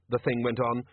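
ordinary delivery

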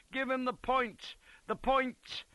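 high-pitched battlefield yell. A narrator's reading. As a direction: yell urgent orders through the melee. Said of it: "yelled a voice".

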